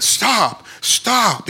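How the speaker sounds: high, frantic mock yell; high-pitched urgent cry; retold raging scream. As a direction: imitate a man hollering in a rage. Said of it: "just like in a rage".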